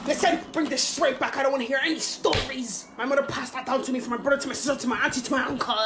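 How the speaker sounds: lecturing parent. Retold or imitated. Parent lending item lecture.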